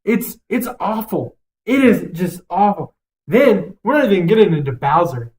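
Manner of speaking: usual speaking voice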